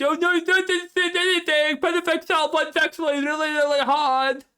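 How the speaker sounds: high, loud whiny mock voice; mocking commenter. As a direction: squeal it like a panicked fanboy. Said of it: "don't even try to be in the comments".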